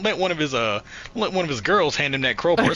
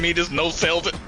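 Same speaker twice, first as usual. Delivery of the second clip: ordinary delivery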